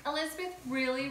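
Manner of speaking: usual speaking voice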